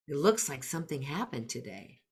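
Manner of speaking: quiet, tentative, leaving room; soft tentative words for a thinker child; gentle observation. Shown as a demonstration. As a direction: say it softly, no pressure. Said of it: "using tentative words".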